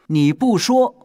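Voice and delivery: loud, high retort; challenging. Acted. King pressing the one who hides the queen's whereabouts, challenging.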